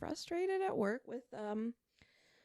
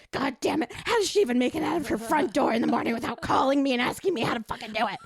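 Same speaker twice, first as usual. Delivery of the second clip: loud, fast exasperated outburst at a coworker; retold furious rant, loud and rushed; frustrated rant; 2 semitones higher, 10 dB louder, faster than usual. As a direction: vent it like you're fed up at work. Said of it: "And I'm like".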